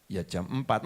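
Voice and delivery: low, soft reply; meek husband. Acted. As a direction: answer her meekly. Husband answers meekly.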